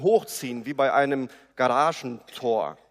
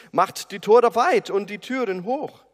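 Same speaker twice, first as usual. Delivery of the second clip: calling out; 6 semitones higher, 7 dB louder than usual. Acted. Crowd calls to open gates.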